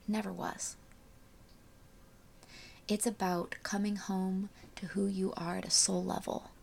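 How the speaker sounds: soft hushed spiritual voice; quiet and airy, almost a whisper; airy breathy mystical healer. Acted. Closing soul message.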